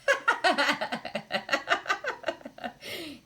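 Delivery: long, high burst of laughter; high belly laugh tailing off; big laugh. Real, not acted. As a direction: crack up laughing for several seconds. Amused by fire talk.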